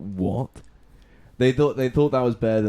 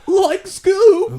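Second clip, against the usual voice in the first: very high, loud Shaggy squeak; cartoon stoner calling his dog; 14 dB louder than usual. Shown as a demonstration. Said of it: "It's like".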